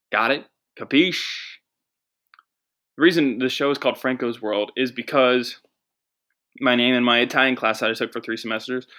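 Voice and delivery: ordinary talk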